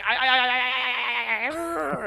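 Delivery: a stuck robot looping and rising to a meltdown; malfunctioning robot. Pretend. Mocking a politician as a robot that breaks down. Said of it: "he has compute meltdown".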